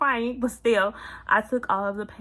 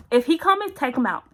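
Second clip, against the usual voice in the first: sharp, high and louder command; urgent panicked order; 6 semitones higher, 10 dB louder than usual. Retold or imitated. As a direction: bark it out like a frantic plea in pain. Panicked in labour, urging husband.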